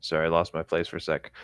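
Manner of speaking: ordinary delivery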